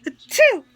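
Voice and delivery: sharp high sneeze; real sneeze bursting out; sudden sneeze; loud sneeze. Real, not acted. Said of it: "excuse me".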